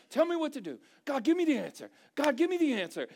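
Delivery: loud, high-pitched mock demand to God; insistent asking. Believers demanding answers from God, insistent. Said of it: "A lot of times we're asking God".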